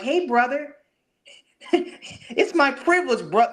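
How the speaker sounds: very high, slowed mock politeness, breaking into laughter; mocking soft brother-talker. Ridiculing how someone would talk to Mike Tyson, high mocking voice. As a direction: imitate an overly friendly guy in a high voice. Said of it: "Anyone who talks like".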